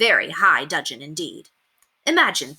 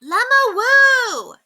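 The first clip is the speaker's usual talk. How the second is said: put-on 1 children's yell; 7 dB louder, much slower than usual. A narrator's reading. Children shouting from street.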